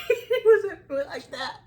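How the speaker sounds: high, slow laughing words. Real, not acted. Laughing while retelling cute moment.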